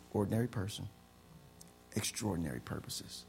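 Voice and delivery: normal talking voice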